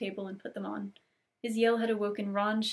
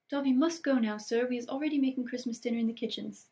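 hurried elf; 3 semitones higher than usual. Acted. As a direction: rush off like a busy house-elf. Voices Dobby.

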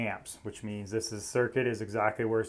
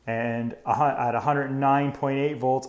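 ordinary talk, nothing marked

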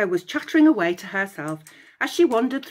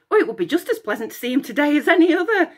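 high, fast sunny reply; fast bright chatter; 7 semitones higher, 5 dB louder, much faster than usual. Acted. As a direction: answer cheerfully, oblivious. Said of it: "Echo replied brightly".

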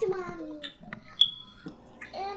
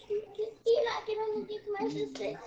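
usual speaking voice

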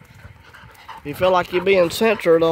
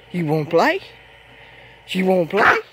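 talking to dogs; 2 semitones higher than usual. Real, not acted. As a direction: sweet-talk the dogs.

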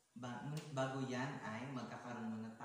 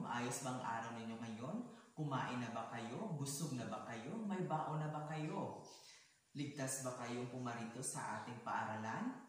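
usual speaking voice

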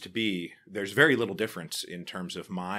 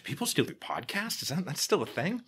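incredulous; 11 semitones higher, 4 dB louder than usual. Retold or imitated. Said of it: "and people say".

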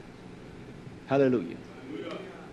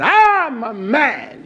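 put-on slow, drawn-out childish boast; lightly voiced boy bragging; mock little boy; 7 semitones higher, 6 dB louder, much slower than usual. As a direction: mimic a little boy puffing himself up. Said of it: "Just like a little boy saying".

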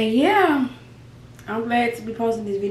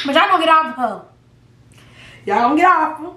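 louder, higher scold; firm scolding; 5 semitones higher, 4 dB louder, faster than usual. Real, not acted. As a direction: tell them off firmly. Defending her friend online.

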